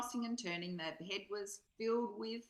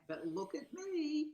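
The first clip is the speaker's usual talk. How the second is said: mock plea in a higher voice, slow and drawn out; voice of the nagging mind; 7 semitones higher, 4 dB louder than usual. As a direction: play the mind begging for attention. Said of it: "and saying".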